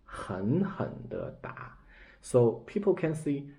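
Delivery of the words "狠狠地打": slow breathy whisper of 狠狠地打. Shown as a demonstration. Teacher repeating 狠狠地打 as a slow whisper.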